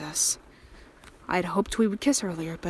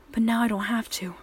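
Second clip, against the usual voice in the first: whisper; 2 semitones higher than usual. Acted. Captor whispering.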